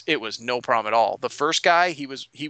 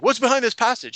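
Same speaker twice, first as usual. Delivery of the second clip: higher, louder acted question; demanding player character; 9 semitones higher, 5 dB louder than usual. Player questioning the bar owner, demanding. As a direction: ask it pointedly, pitch raised. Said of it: "And he's like".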